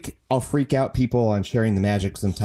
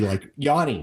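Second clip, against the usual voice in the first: usual speaking voice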